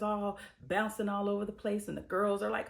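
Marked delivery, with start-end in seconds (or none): none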